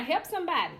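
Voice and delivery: ordinary talk, nothing marked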